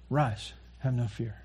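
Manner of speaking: very soft, gentle comfort; acted tender voice of Jesus; tender reassurance. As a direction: soothe the frightened quietly. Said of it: "this tender moment of Jesus".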